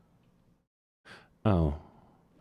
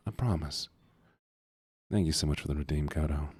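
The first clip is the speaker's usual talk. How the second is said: tender sincerity; 5 semitones lower, 4 dB quieter than usual. Real, not acted. Heartfelt thanks to a viewer.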